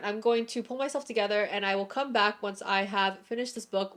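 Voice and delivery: ordinary delivery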